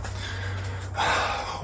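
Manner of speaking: slow unvoiced exhale; long sigh. Real, not acted. Settling in before speech.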